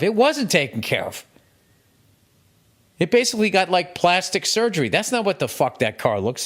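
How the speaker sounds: comic outrage building to a peak; building rant. Real, not acted. Ranting about detailed junk cars.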